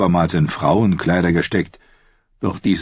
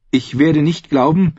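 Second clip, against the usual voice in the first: voiced higher as the young woman Brienne; shy woman knight voice, pitched up; Brienne's voice; 10 semitones higher, 3 dB louder than usual. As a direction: lift into Brienne's earnest higher voice.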